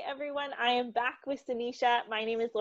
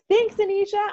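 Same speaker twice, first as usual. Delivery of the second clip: laughing; 11 semitones higher, 11 dB louder than usual. Real, not acted. Laughing thanks at end.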